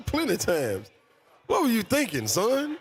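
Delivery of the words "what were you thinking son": high-pitched, incredulous telling-off; mock parental scolding. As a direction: play a parent demanding an explanation. Acted scolding after a slap.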